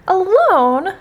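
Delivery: high, taken-aback query; surprised question. A narrator's reading. Toad reacting to Frog's note, surprised.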